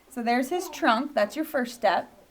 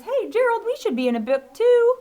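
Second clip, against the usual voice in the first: much higher and louder cartoon character call; storybook character voice; 11 semitones higher, 9 dB louder than usual. Teacher reading a character line to the class, high cartoon voice. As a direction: call out to Gerald like an eager cartoon pig. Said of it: "then it says".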